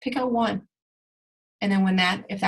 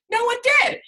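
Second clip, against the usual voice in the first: high squeak; 5 dB louder than usual. Real, not acted.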